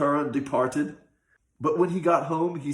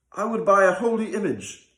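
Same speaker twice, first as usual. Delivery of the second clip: put-on higher, earnest young fellow pleading; a youth begging the old man to stop; young man's voice; 7 semitones higher, 5 dB louder, faster than usual. A narrator's reading. Young man urging the rich man to stop beating, earnest. Son asks father.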